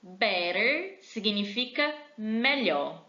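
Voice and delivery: usual speaking voice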